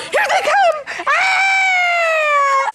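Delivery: mock panic. Acted skit, enemies arriving.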